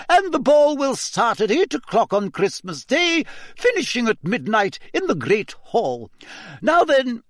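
high, clipped Scottish schoolmistress; a strict old teacher addressing the class; stern McGonagall. Acted. Teacher announcing the ball to the class, stern and high.

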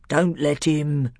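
plain reading voice

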